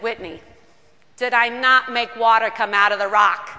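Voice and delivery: a hint of a Brooklyn scolding sister; frank Brooklyn God. God scolds Whitney, hint of Brooklyn, loud. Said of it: "Brooklyn might be the best way".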